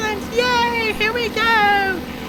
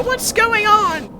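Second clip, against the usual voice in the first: normal talking voice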